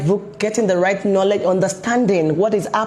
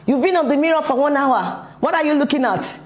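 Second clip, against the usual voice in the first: higher, scolding parent quote; nagging parent; 6 semitones higher than usual. Retold or imitated. Parent scolding a teen about mirror time, higher nagging voice. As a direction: play the exasperated mom nagging her teen. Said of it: "Or they're just saying".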